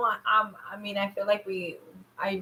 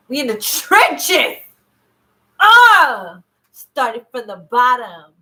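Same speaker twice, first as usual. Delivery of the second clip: loud, slow, drawn-out holler; hammed-up high, loud groaning slogan; mock dramatic rallying; 4 semitones higher, 12 dB louder, slower than usual. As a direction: belt it out from the trenches. Hyped hardship slogans, mock dramatic.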